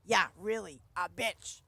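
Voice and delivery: loud retold comeback, punching "bitch"; emphatic comeback. Storyteller retelling their own comeback, emphatic. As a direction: fire it back loudly, landing on "bitch". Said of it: "I emphasized the word bitch".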